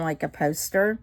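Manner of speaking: ordinary delivery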